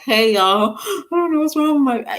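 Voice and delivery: mock vlogger greeting. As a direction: play a vlogger filming in a crisis. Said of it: "I'm not gonna grab my phone and say".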